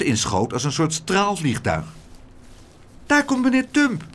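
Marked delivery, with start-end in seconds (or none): 3.1-4.0 high, excited call. A narrator's reading. Laura spots meneer Tump returning to the group, calling out. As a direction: call out the arrival in a raised pitch. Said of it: "riep Laura".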